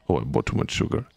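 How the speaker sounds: ordinary delivery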